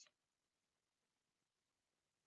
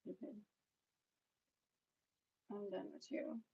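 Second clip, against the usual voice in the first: much softer, muttered brush-off; muttered dismissal; 15 dB quieter than usual. Real, not acted. Telling off a rude commenter, muttered softly. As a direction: mutter it, done with them.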